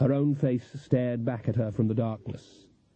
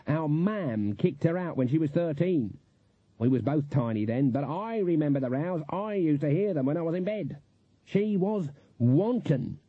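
indignant Granny; 4 semitones higher than usual. Acted. Granny recalling her disgraced sister, indignant. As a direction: dwell on the damning words, higher-pitched.

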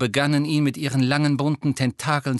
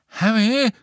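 calling out; 8 semitones higher, 5 dB louder than usual. A narrator's reading. Neville calls across room.

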